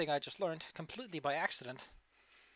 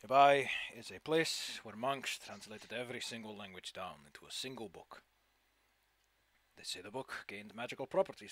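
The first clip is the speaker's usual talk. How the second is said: put-on mysterious old sage; 4 semitones lower than usual. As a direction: speak like a secretive old hermit. GM voices NPC describing temple.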